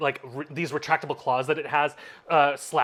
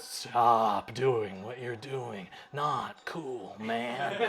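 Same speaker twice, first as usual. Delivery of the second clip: slow, soft, lower stoner drawl; laid-back stoner voice; 4 semitones lower, 7 dB quieter, much slower than usual. Acted. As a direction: chill dude telling them to cut it out. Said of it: "a new voice, uh, that just goes".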